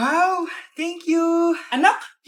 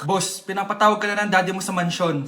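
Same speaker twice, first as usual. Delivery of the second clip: low butler voice; 10 semitones lower, 5 dB quieter than usual. Acted.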